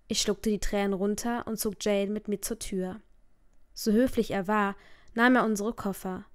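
ordinary delivery

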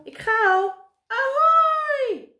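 high, loud call into a long screech; bird's shout. A narrator's reading. Karel sets off in flight, high shrieking call. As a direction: squawk it, rising to a drawn-out shriek. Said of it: "riep Karel".

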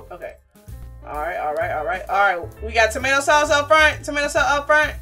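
normal talking voice

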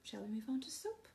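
whispered aside to the co-host; hushed whisper to a partner while presenting. Real, not acted. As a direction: drop to a breathy whisper, as if off-mic. Aside to co-host mid demo.

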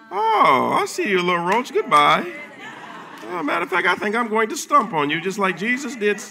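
high, loud taunting voice; gleeful high mock taunt; mocking the devil. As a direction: sneer down at a little roach. Preacher voicing mock victory over roach.

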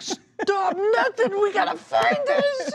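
high, loud, drawn-out frazzled shriek; hammed-up panicked retort; frantic retold yell. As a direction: act out a mom losing it. Frantic mother snapping back at son.

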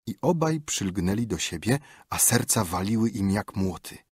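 ordinary delivery, nothing marked

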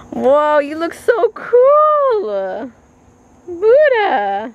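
gasping in delight; wide-eyed slow amazement; excited. Real, not acted. Amazed at a cool car.

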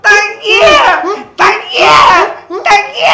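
high, loud cries to sister; repeated high-pitched wail; screaming for help. Acted. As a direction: yelp out as you are beaten. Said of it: "ಚೀರಾಡತ್ತಿದ್ದ".

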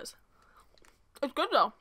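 talking while chewing food; covering mouth while chewing; mouth full, chewing. Real, not acted. Seen 3: Bites bar at 0.8, then chews behind hand while talking.

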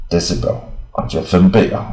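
ordinary delivery

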